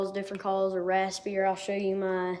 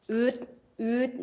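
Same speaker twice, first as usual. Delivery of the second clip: short hooting oot sounds, higher; duck call oot; 4 semitones higher than usual. Shown as a demonstration. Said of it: "what I make into the duck call is a".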